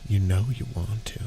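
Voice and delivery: low seductive purr; sexy voice. Acted. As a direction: tease it, low and sultry. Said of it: "I'll read this in a sexy voice".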